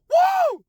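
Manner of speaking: high, loud whoop of joy; joyful whoop. Real, not acted. Celebrating a year sober on the ride home, big high whoop. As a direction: let out a big celebratory woo.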